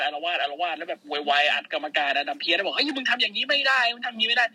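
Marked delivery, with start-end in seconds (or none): 2.8-4.4 mock angry protest. Lashley yelling at referee.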